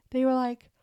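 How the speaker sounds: words stretched by a real yawn. Real, not acted. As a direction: let a yawn pull the words out. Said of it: "sorry for the yawn".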